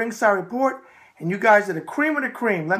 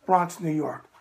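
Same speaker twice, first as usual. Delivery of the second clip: ordinary talk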